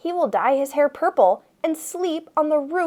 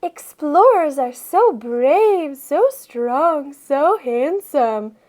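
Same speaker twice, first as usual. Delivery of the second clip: dreamy swoon; 2 semitones higher, much slower than usual. Acted. Mock swooning reading.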